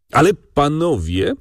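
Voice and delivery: mildly whining protest to fellow gentlemen; tearful whine. A narrator's reading. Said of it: "ciągnął dalej płaczliwym głosem". (płaczliwym, podwyższonym głosem)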